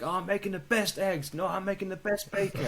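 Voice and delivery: high, proud mock cook voice; boastful guide. Guide showing off breakfast to the camera, high and proud.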